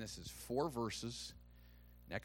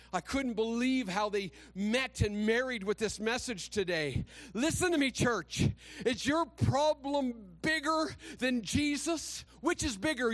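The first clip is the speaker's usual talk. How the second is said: louder, higher impassioned preaching with shouted peaks; preaching at full force; 10 semitones higher, 6 dB louder than usual. Real, not acted. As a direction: fire up the church. Preaching climax to congregation.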